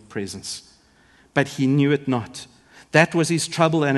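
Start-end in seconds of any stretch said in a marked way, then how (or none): none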